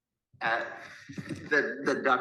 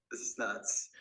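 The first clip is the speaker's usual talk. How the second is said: whisper; 2 semitones lower, 9 dB quieter than usual. Retold or imitated. Stunned aside at police.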